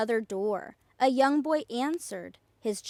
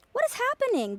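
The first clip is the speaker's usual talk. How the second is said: much higher, wondering question; curious; 12 semitones higher, faster than usual. A narrator's reading. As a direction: ask it wide-eyed and curious. Curious townspeople ask.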